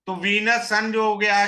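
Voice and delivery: usual speaking voice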